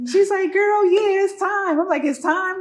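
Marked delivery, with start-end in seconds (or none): 0.5-1.8 high, loud, certain exclamation; retold cousin's emphatic confirmation, pitched up. Cousin telling a first-time mom the contractions mean labour, emphatic. 2.0-2.6 high echo question, rising at the end; retold disbelief, voice lifting into a question; startled question. Mother-to-be hearing that labour has started, startled question.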